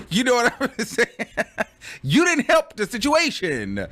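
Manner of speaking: high-pitched, tickled protest; laughing while talking. Real, not acted. Mocking the brother who didn't help, laughing. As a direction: squeeze the words out through giggles. Cracking up at own joke.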